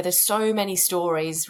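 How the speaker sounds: ordinary talk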